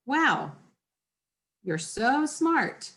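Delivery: slow, drawn-out mock praise; a dry, eye-rolling wow; sarcastic. Shown as a demonstration. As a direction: drip with sarcasm, unhurried. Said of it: "I'm being sarcastic".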